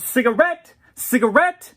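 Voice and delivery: loud, high shout of one word; yelling. Acted. Drag queen demanding a cigarette from the crowd, loud high yell. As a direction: holler it to the whole sidewalk. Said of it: "I yelled".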